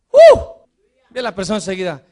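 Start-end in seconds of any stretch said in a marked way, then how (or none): 0.1-0.4 excited whoop. Real, not acted. Excited exclamation.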